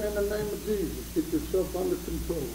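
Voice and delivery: man steadying his nerves when he sees a cat, hushed; hushed self-rebuke. Retold or imitated. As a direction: quietly command yourself in Jesus' name. Rebuking himself before a cat.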